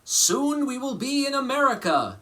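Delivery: high, loud eager hope; hopeful musicians. Acted. Musicians on the boat dreaming of America, eager and hopeful. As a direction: call it out full of hope. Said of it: "they said".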